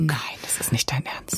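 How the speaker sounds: voiceless, stunned whisper; hushed real incredulity; disbelieving whisper. Real, not acted. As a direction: whisper it as if you can't believe the story. Disbelief at the freight elevator story.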